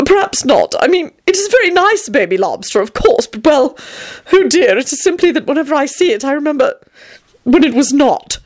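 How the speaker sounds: high, flustered backtracking; mildly rattled, halting excuse; weakly hesitant. A narrator's reading. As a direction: dither uneasily, trying to be polite. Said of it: "Tony murmured weakly".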